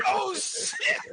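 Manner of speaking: high, loud startled cry; shocked yelp. Retold or imitated. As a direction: jump out of your skin. Scared reaction to bear.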